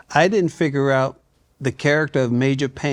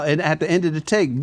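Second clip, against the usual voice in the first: normal talking voice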